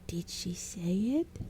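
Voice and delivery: slow, bright goblin question; cheerful dim goblin; bright stupid goblin. Acted. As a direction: ask eagerly and dimly. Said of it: "asked brightly".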